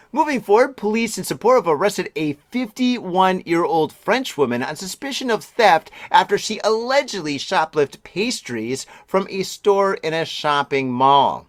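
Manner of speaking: brisk newsreader delivery; news read. Acted. Reading the news story to the listeners as an anchor.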